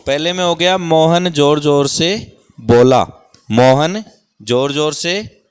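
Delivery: loud, slow teacher reading of Mohan जोर जोर से बोला. Shown as a demonstration. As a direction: call it out slowly to the whole class. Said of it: "teacher reads sentence aloud". (ज़ोर से धीरे-धीरे पढ़ना)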